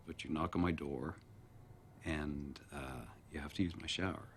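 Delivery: low, soft, halting phone voice; sheepish, hesitant. Acted. First-time caller asking a stranger for fantasy, sheepish. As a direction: mumble shyly with awkward pauses.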